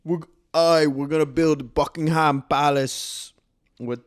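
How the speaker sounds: playful fake British accent; mock British accent. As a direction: ham up a British YouTuber. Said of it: "when the British accents come out".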